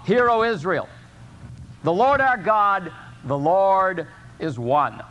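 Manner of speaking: slow, measured scripture recital; solemn recitation. Acted. Pastor recites the Shema to his congregation, slow and solemn. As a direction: proclaim each line slowly, like a creed.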